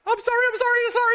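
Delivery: squeaky high, frantic repeated sorry; panicked apology. Retold or imitated. Pastor imitating himself apologizing to a friend, high and frantic. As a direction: beg forgiveness in a tiny, scared voice.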